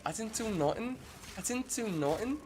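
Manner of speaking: very high-pitched mock Scouse; butchered Scouse accent. Streamer imitating his date's Scouse phrase, butchered accent. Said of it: "I'll do a butchered version".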